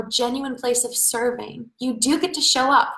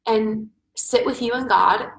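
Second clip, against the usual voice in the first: ordinary talk, nothing marked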